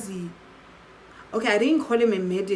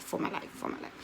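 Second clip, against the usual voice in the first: whispered repeat; 10 dB quieter, faster than usual. Retold or imitated. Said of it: "I'm just so scared".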